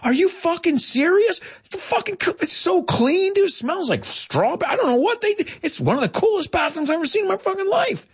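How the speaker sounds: hammed-up gushing about a clean bathroom; over-the-top American rave. A teacher mocks an American raving about a bar bathroom, high and gushing. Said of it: "That's an American's reaction".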